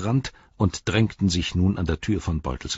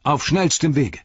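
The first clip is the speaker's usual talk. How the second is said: stern command; 9 semitones higher, 5 dB louder than usual. Acted. Frodo dismisses Saruman.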